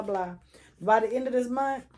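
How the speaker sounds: usual speaking voice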